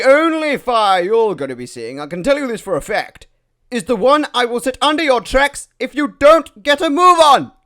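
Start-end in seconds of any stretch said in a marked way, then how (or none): 3.7-7.5 angry threat. Acted. Threatening engine shouting angrily.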